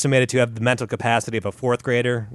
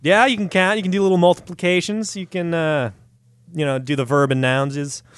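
condescending; 6 semitones higher than usual. Acted. Mocking a fourth grader's abilities.

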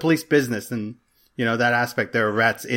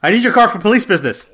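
stern cop voice; 8 semitones higher, 6 dB louder than usual. Pretend. Mock cop line about police business.

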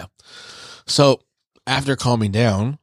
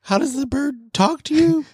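much higher, squeaky panicked voice; shrill Zenitsu. Retold or imitated. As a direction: squeak it out in disbelief. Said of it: "He's like".

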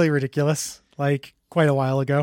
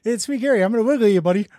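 higher, louder playful skit voice; put-on character chat; 5 semitones higher, 5 dB louder, faster than usual. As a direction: play both ends of a silly sketch, pitched up. Acts out a scene between two figures.

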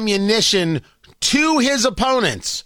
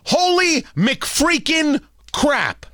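drawn-out exasperation; 6 semitones higher, much slower than usual. Real, not acted. Exasperated at Trump's answer.

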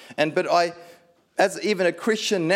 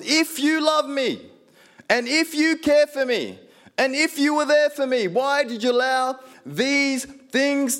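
desperate plea to God; 9 semitones higher, slower than usual. Retold or imitated. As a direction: pour out the grievance, pleading hard. Angry complaint to God, shouted.

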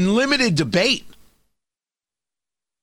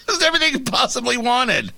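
laughing; 5 semitones higher, faster than usual. Real, not acted. Laugh heard.

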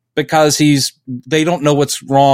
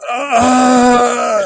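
mock groaning stand-in; 9 semitones higher than usual.

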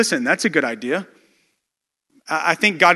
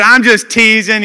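laughing; 4 semitones higher, 8 dB louder, slower than usual. Retold or imitated. Pastor laughs off his remark.

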